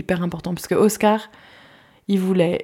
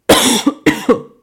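loud sudden cough; coughing fit; 6 semitones higher, 8 dB louder than usual. Real, not acted. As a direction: cough hard mid-sentence. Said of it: "pardon, je tousse".

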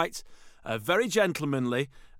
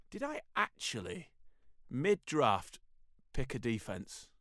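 quiet disbelief; 5 semitones lower, 8 dB quieter, slower than usual. Retold or imitated. Retells his own thought.

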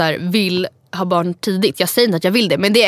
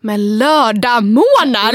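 incredulous; 2 semitones higher, 3 dB louder, much slower than usual. Retold or imitated. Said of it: "Jag bara".

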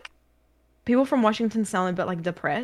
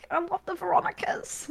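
mock Queensland accent; 9 semitones higher, 5 dB quieter than usual. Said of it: "that's what".

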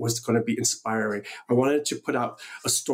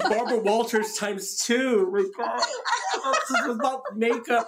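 laughing; 11 semitones higher than usual. Real, not acted. Laughs off tearful moment.

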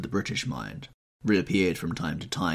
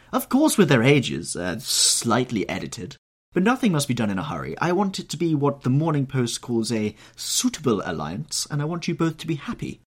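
higher, louder society matron; Lady Narborough voice; 7 semitones higher, 4 dB louder than usual. Acted. Old hostess fussing over Dorian, higher and louder.

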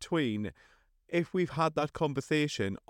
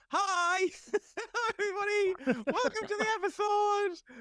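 very high, chirpy mock intro; overexcited host intro. Stephen mocks his own intros in squeaky high voice. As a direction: gush like an over-eager host. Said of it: "I'm just like".

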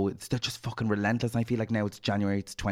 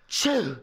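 loud mock sneeze, pitched high; fake sneeze; 13 semitones higher, 3 dB louder than usual. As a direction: burst out a fake sneeze to startle. Fake sneeze spraying new people.

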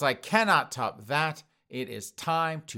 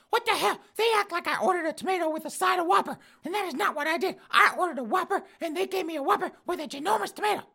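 put-on old woman voice; faster than usual. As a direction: squawk it out like an outraged old lady.

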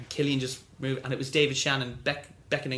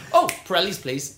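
mock eager pick; 5 semitones higher, 7 dB louder than usual. Panel picking song eagerly.

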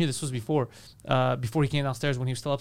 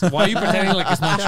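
mock accusing; 5 semitones higher, 6 dB louder than usual.

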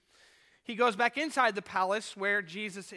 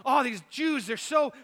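higher, louder, fed-up muttering of an annoyed governor; exasperated Pilate; 6 semitones higher, 6 dB louder than usual. Retold or imitated. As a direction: vent like a ruler sick of these people. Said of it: "Pilate pacing around his palace, thinking and saying to himself".

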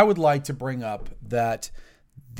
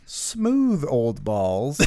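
drawn-out, very slow mock-smooth boast; deadpan, stretched punchline; much slower than usual. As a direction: savour each word like a joke.

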